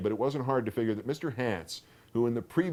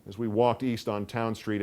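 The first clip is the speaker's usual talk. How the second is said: ordinary delivery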